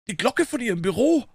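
high-pitched doorkeeper voice; erstaunter Türhüter. A narrator's reading. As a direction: answer in amazement, pitched well up. Türhüter antwortet erstaunt Phelps. (erstaunte, hohe Türhüterstimme)

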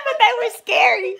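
high voice breaking into laughter; laughing retold reply. Quoting her sister's reply about the dream, laughing. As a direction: squeeze the quote out through a laugh.

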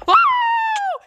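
high startled shout; shocked shriek. Real, not acted. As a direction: yelp as you fall. Pushed in, shriek.

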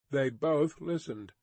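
ordinary delivery

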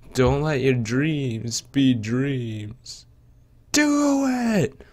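slow, intense motivational shout; Shia LaBeouf motivational voice. Retold or imitated. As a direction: push him to chase his dreams. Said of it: "We'll play as Shia LaBeouf".

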